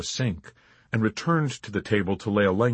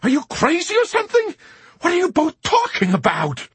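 much higher, louder outburst; indignant anger; 7 dB louder than usual. Acted. Chaplain snapping at the major and colonel, angry. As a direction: lash out in disbelief. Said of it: "wave of anger and indignation".

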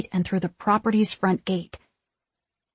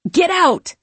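high, loud urgent shout; 10 semitones higher, 6 dB louder than usual. A narrator's reading. Max to the two girls, shouting. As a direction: yell it as an order to flee. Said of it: "he shouted at the two girls".

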